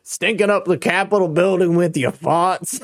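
light mock Southern rooster voice; mocking a cartoon Southern voice; Foghorn Leghorn. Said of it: "Sounds like Foghorn Leghorn".

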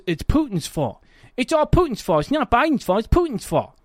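high, dopey voice parroting the excuse; mocking dim voter. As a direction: play a clueless voter blaming Putin. Mocking low-information voters' excuse.

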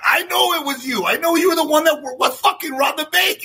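high-pitched incredulous rant; acted exasperated accusation; outraged witness. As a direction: keep the voice way up, indignant.

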